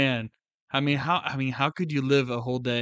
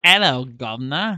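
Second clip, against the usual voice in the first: mock British accent; 8 dB louder than usual. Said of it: "that was probably British".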